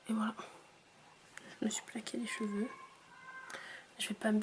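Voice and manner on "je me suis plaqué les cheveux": hushed unvoiced whisper. Real, not acted. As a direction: whisper it, voice nearly gone. Whispering, sick voice.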